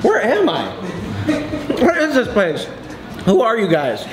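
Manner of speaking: ordinary delivery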